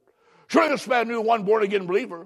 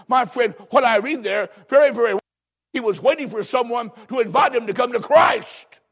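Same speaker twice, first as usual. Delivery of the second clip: loud, rising revival preaching; preaching at full force; 4 semitones higher, 4 dB louder than usual. Real, not acted. Preacher urging the church to witness, full force. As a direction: drive the point home to the congregation.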